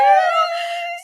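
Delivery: high, loud squeal of delight; excited squeal. Real, not acted. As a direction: squeal with excitement at being reunited. Excited at being together.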